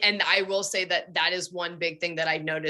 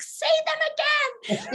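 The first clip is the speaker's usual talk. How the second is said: much higher, slowed, drawn-out playful demand; mock pleading demand; slower than usual. Wife teasing her husband for more compliments, high mock plea. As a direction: tease him into repeating the compliment. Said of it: "And I'm like".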